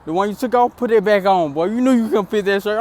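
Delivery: high, loud mocking woman's voice; mocking female voice. Woman in the truck mocking him, high and loud. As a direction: tease him like the laughing woman. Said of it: "She said".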